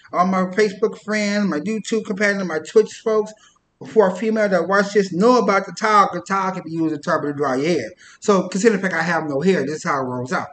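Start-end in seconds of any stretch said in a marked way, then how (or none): none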